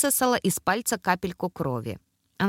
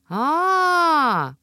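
long, high, loud drawn aah; drawn-out realizing aah; 8 semitones higher, 5 dB louder than usual. A narrator's reading. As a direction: stretch out a dawning realization. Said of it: "протянула Зи".

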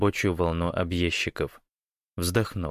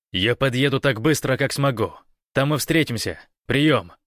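raised, louder, determined voice; urgent radio message; 5 semitones higher, 4 dB louder than usual. A narrator's reading. Гош arranges to meet at the ranch, urgent and firm. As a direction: set the meeting firmly over radio.

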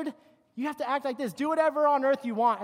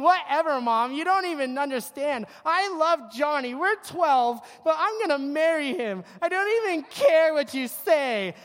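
put-on higher, louder bratty teen girl; mock bratty teen; 4 semitones higher, 6 dB louder than usual. Daughter defying her mother over Johnny, loud bratty whine. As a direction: whine it like a twelve-year-old in love. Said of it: "the next time saying".

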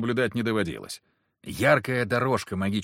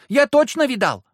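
put-on loud, very high childish protest; insistent small boy; 9 dB louder than usual. A narrator's reading. Small boy defends his story, squeaking insistently. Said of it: "настаивал пацан".

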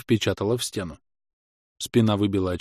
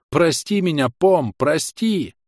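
raised, drawn-out plea to the horse; pleading cry; 7 semitones higher, 3 dB louder, slower than usual. A narrator's reading. Hero calling out to his horse Pom, pleading raised voice. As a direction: call out begging forgiveness, slowly. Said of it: "крикнул я".

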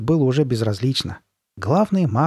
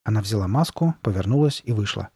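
usual speaking voice

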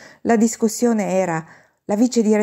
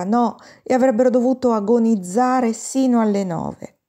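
ordinary delivery, nothing marked

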